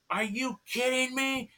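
a high-pitched, slow, strained "are you kidding me"; a pained plea thrown up at the sky, pitched high; exasperated outburst. Retold or imitated. A pallbearer alone in the morgue at night, exclaiming to God in high-pitched exasperation. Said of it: "And I just, I get angry".

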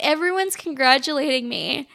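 ordinary talk, nothing marked

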